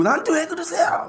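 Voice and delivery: high, whiny mock-teen protest with a laugh; sulky teen. Preacher mocks high schoolers complaining, high whiny voice. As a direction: mimic a put-upon teenager, laughing. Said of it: "나중에 승질내고".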